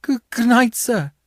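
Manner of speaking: put-on nervous child stammering goodbye; faltering. A narrator's reading. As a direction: falter it out like a frightened boy. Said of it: "I faltered".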